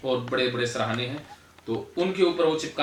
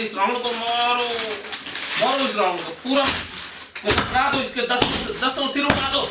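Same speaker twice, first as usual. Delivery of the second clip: higher, driving coach's urging, chanting commands; fired-up exhortation; 8 semitones higher, 3 dB louder than usual. Acted. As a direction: whip the group into venting their rage. Catharsis guide urging anger release. (जोश भरा, ऊँचा, उकसाता हुआ आह्वान)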